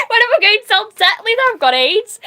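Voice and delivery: high-pitched words through real laughter; laughing incredulously. Real, not acted. Streamer repeating partner's absurd question, in fits of laughter. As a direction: barely get it out for laughing.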